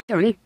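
sharp gasp. Acted. Retelling Tony's sudden gasp.

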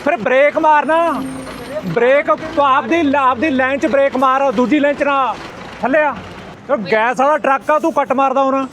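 high, heated shouting at a driver; real angry roadside argument; angry yelling. Real, not acted. Roadside quarrel with truck driver.

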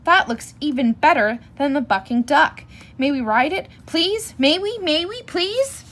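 pleading that climbs high into a long please; eager begging. A narrator's reading. Cubs begging their parents for a ride, pleading. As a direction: beg like an eager little cub.

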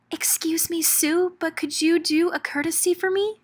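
ordinary delivery

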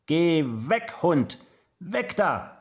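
high-pitched, brave shooing; acted command. A narrator's reading. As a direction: send the dog away firmly. Boy bravely sends big dog away.

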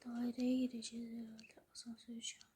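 hushed whisper while sneaking; tense whisper. Real, not acted. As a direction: whisper so nothing hears. Sneaking through scary game.

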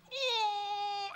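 high gasp of shock, imitated; old client collapsing, a high imitated gasp; mock pained gasp. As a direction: gasp as if clutching the heart. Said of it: "he goes".

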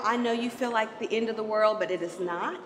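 usual speaking voice

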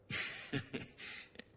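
short unvoiced-breath chuckle; a brief, low-volume laugh to oneself. Real, not acted. Reacting to their own word, a short chuckle.

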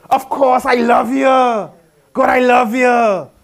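loud, slow, fervent shout of love; eager shout. Pretend. Preacher mocks easy obedience, shouting eager love to God. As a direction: cry out joyfully to God, drawn out.

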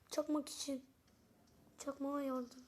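ordinary talk

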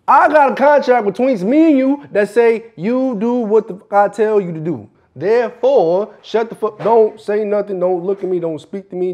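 angry ordering. Acted. Boss citing contract to silence worker, loud angry orders. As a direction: lay down the law loudly.

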